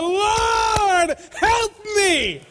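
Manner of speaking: high, loud cry for help; desperate cry out. Acted. Preacher voicing Peter sinking in the waves, crying out loud. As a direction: shout it like a drowning man. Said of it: "This was a".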